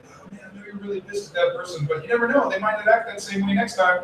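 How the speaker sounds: gentle reassurance to coworker. Retold or imitated. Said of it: "you're like".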